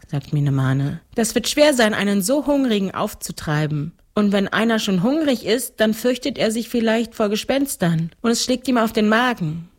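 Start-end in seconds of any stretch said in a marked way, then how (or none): 1.1-9.7 put-on higher little-girl voice; seven-year-old girl. (kindliche Mädchenstimme)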